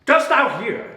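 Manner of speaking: high, loud challenge; piercing testy demand; sharp demand. Acted. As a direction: jolt her with the question. Prospero demands Miranda's attention.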